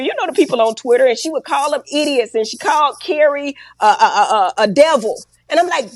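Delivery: ordinary talk, nothing marked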